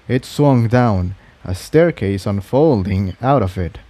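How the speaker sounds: ordinary delivery, nothing marked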